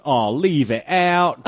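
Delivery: slow, loud, hammed-up Cockney accent; bad Cockney accent. Host imitating Don Cheadle in Ocean's Eleven, bad Cockney accent. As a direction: imitate a weird film Cockney, drawn out. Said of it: "like, Cockney accent".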